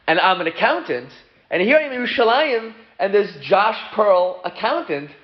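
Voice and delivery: high, animated disbelief; excited amazement. Acted. Traveler finds his namesake's office in Yerushalayim, excited amazement. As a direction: pitch it up, thrilled by the coincidence.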